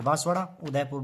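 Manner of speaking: ordinary talk, nothing marked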